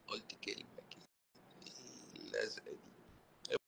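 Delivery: a conspiratorial whisper; whispered aside. Pretend. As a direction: drop to a secretive whisper as if telling tales. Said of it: "وأنا قلت كلمة كده،".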